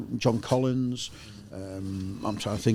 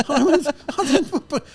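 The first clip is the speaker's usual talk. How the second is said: laughing disbelief; 6 dB louder than usual. Retold or imitated. Shocked at the newspaper claim.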